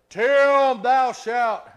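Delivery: huge, slow, drawn-out proclamation; thundering voice of God. Acted. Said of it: "the thunderings of God's voice".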